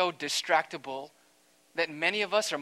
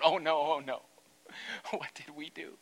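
panicked breathless whisper with a laugh; the demons whisper in horror; frightened demons whispering; 4 dB quieter than usual. Pretend. Demons cry out in fear.

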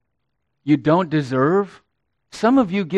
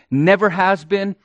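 ordinary talk

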